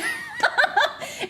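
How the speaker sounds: high-pitched real laughing fit. Real, not acted. Streamer laughing at the therapist's question, high giggling.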